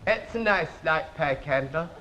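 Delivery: the king's voice. Retold or imitated. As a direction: mumble it low like a lazy king. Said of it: "He talks like this".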